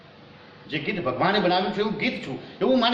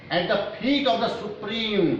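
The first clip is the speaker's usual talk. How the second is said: slow, raised verse reading; poem recitation; 4 semitones higher, much slower than usual. Real, not acted. Teacher reads poem line before translating, slow recitation. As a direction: recite it slowly, pitched up.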